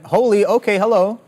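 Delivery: high, loud startled "holy, okay, hello"; surprised. Real, not acted. Host reacts to the AMD chart on stream with startled surprise. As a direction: caught off guard by the chart.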